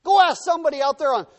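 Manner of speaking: loud, high, fiery pulpit delivery; full-force preaching. Real, not acted. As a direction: drive it home to the congregation.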